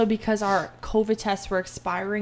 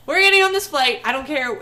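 higher and louder, insisting hard; retold furious demand; 4 semitones higher, 5 dB louder than usual. Traveller telling the gate agent they will board, loud demand. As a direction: demand it like nothing will stop you.

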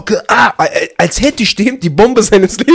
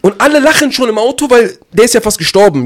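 ordinary talk, nothing marked

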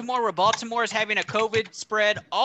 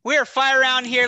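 higher, louder, slow announcer hype; 3 semitones higher, 4 dB louder than usual. Acted. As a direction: kick off the round like a game-show host. Host kicks off segment over music.

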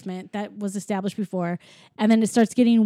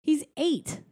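much higher, insistent disbelief; incredulous protest; 8 semitones higher than usual. Real, not acted. Podcaster insists on the eight-year-old's feat, incredulous protest. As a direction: object in high-pitched amazement. Protests co-host's minimizing correction.

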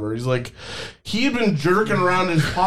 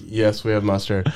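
flat cashier; 8 semitones lower than usual. Retold or imitated. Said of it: "she's like".

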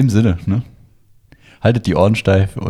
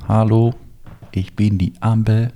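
robot voice. Pretend.

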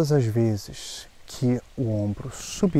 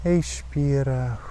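slow, calm breathing guide; slow breath counting; 6 dB quieter, slower than usual. Acted. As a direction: count the breaths slowly and gently.

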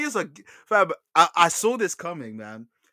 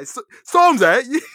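bad British impression; 8 semitones higher, 12 dB louder, slower than usual. Podcaster reprises his bad accent for co-host, loud drawn-out "[name]". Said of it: "I'm sorry I'm bad at accents".

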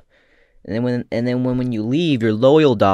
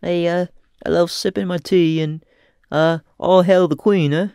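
hammed-up, higher-pitched British guy; British accent; 5 semitones higher than usual. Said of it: "When you do a British guy, you go".

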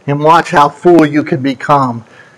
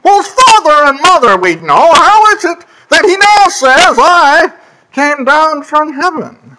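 rising disbelief, pitched higher as it goes; scoffing crowd; 7 semitones higher than usual. Retold or imitated. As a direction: sneer it like doubting neighbours. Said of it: "And they said".

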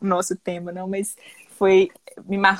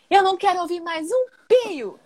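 strict teacher; 9 semitones higher, 3 dB louder than usual. Retold or imitated. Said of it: "ela vira e fala assim".

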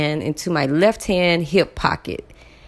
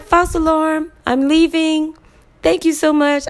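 relieved apology; 13 semitones higher than usual. Retold or imitated. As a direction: gush it out, giddy with relief. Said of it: "So I was like".

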